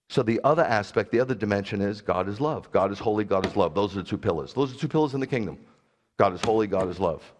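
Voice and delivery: ordinary talk, nothing marked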